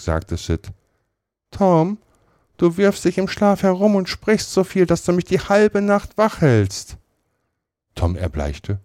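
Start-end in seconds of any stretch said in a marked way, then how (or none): 1.5-7.0 höher, kindlich petzend; Sid. A narrator's reading. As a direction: sprich wie der kleine Bruder. Sid als Figur. (Sid petzt beim Frühstück, höher)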